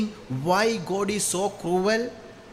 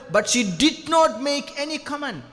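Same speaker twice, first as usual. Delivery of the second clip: preaching climax; 8 semitones higher than usual. Real, not acted. Preaching climax about Mary.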